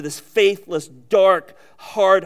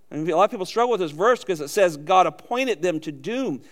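ordinary talk

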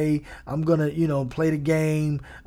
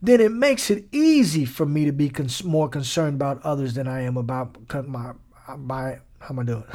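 ordinary talk, nothing marked

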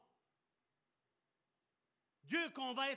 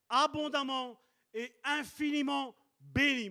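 loud, higher, slowed blessing; full-force preaching; 5 semitones higher, 8 dB louder than usual. Acted. Preacher blesses the congregation, loud and building. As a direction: proclaim the blessing with full power.

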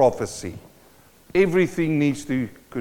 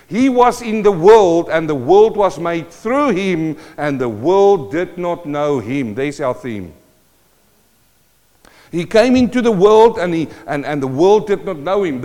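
scripture reading; 5 semitones higher, 7 dB louder than usual. Acted. Reads John 1 aloud.

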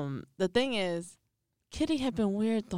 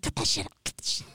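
hushed warning; faster than usual. Retold or imitated. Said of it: "Beans looked at him like".

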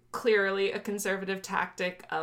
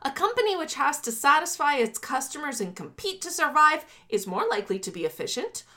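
raised, oratorical political read-aloud; formal manifesto reading; 6 semitones higher than usual. Acted. Host reading privatization section to co-host, declaimed. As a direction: declaim it in rising, emphatic phrases.

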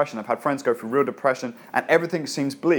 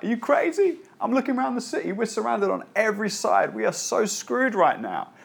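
much higher, squeaky panicked voice; panicked servant; 9 semitones higher than usual. Pretend. Pastor voicing the frightened servant to Elisha, high and panicked. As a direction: pitch up high in disbelief and alarm. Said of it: "the servant's like".

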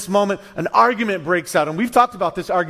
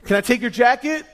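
friendly host; 7 semitones higher, 3 dB louder than usual. Retold or imitated. Host greeting guest.

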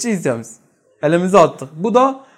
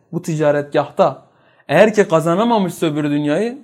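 ordinary delivery

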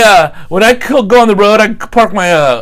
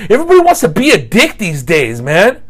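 ordinary delivery

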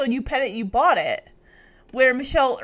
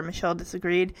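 ordinary delivery, nothing marked

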